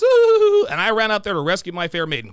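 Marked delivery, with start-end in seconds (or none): 0.0-0.7 high, loud teasing squeal; mock squeal. Mocking the girls' prank with a teasing squeal.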